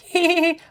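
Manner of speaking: high, loud put-on giggle; weird mock snicker. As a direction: giggle squeakily like a creep in a corner. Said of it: "laughing in a corner, being super weird".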